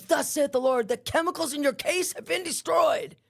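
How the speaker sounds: imitating a woman declaring God's word; prophetic proclamation. Woman giving a word from the Lord through the vent, prophetic proclamation.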